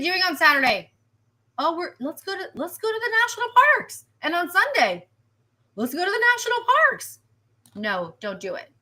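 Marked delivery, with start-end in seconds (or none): 1.6-7.2 high, quick, breezy voice of eager park-goers; mock the weekend crowd heading out, high and fast; carefree tourist. Forecaster mimicking carefree hikers, high and breezy. Mocking what everybody does Saturday.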